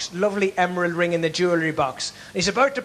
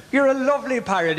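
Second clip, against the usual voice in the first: relieved burglar; 6 semitones higher than usual. Acted. Relieved burglar flatters parrot.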